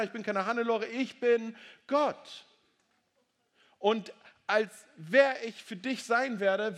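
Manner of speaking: booming preacher proclaiming God's words; preaching at full force. Acted. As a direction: proclaim it from the pulpit. Preacher voicing God, raised.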